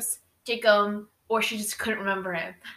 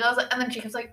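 usual speaking voice

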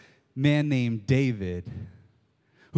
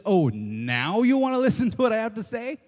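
higher, mocking now-you-want-to-listen; sarcastic Samuel; 8 semitones higher, 4 dB louder than usual. Samuel mocking Saul, sarcastic and higher. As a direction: drip with sarcasm. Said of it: "And Samuel says".

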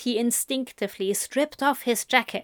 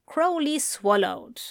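ordinary delivery, nothing marked

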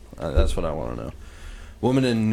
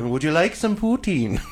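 high, laughing mock Canadian offer; 9 semitones higher, 5 dB louder than usual. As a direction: ham up a Canadian host offering poutine. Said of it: "I love Canadians".

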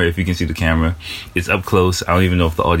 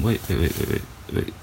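hushed; 9 dB quieter than usual. Real, not acted. Sudden realisation, hushed to self.

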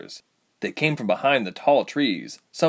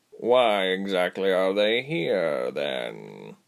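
slow, drawling cat voice; tabby leader; much slower than usual. A narrator's reading. Tabby questioning the captor, slow drawl.

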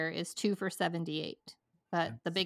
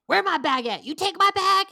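higher, louder accusing questions; paranoid customer; 10 semitones higher, 8 dB louder than usual. Retold or imitated. Old customer waking up accusing clerk of taking his bag, raised and loud. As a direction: demand it like he's been robbed. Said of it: "And he goes".

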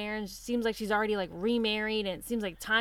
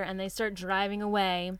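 ordinary talk, nothing marked

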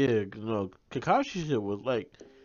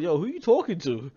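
lightly acted, higher-pitched question; imitated puzzled onlooker; 5 semitones higher than usual. People come to check on the prince, imitated puzzled question. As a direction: put on a bystander checking on someone.

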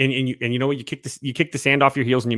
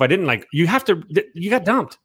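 ordinary talk

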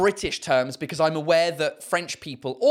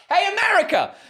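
much higher, louder shout through laughter; laughing call-out; 6 dB louder than usual. Real, not acted. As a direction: hail America like calling across a field. Calling out to Americans.

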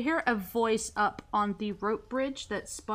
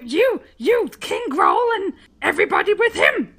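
louder, pointed guard voice; lightly voiced goblin accusing them; a hint of indignant guard; 6 semitones higher, 11 dB louder than usual. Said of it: "he says".

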